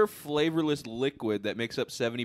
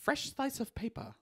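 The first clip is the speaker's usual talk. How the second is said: creepy teacher voice; 11 semitones higher, 7 dB quieter than usual. Retold or imitated. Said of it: "he used to say".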